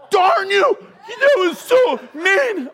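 high, loud outraged yell at a prankster; indignant shout. Retold or imitated. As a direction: shout it, half furious, half laughing. Caught by a prank, yelling at Frank.

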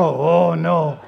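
mock dismay. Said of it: "I just wanted to see them all go".

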